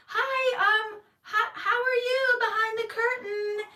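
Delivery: high, shaky, anxious hello; nervous greeting. Retold or imitated. To the stranger behind the curtain, high anxious greeting. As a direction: squeak out a scared hello.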